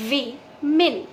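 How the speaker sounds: usual speaking voice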